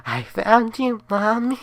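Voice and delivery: put-on the undead boy finding his hidden mother; sinister child voice. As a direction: gloat like a ghost child. Ghost child finds mother.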